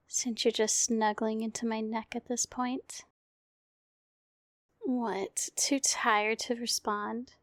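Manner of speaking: hushed, tender bedtime voice; gentle murmured ASMR comfort. Acted. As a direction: lull a sleepy partner off to sleep. Whole comfort roleplay.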